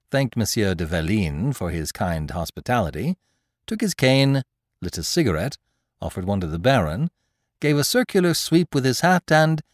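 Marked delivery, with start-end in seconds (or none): none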